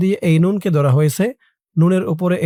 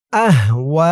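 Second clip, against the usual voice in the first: আহওয়া in one go, last vowel held long; joined word; much slower than usual. Shown as a demonstration. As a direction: run আহওয়া together into a drawn-out ending. Teacher recites word joined.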